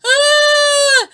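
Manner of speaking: high, loud terrified scream. A narrator's reading. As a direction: shriek in fright. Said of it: "Kahawai screamed".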